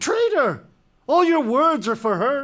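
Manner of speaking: high, loud accusation; furious shout. Acted. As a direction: roar it at your son. King explodes at son.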